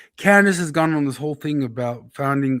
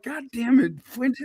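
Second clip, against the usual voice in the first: grudging exasperation; 10 semitones higher than usual. Retold or imitated. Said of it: "you're kinda like".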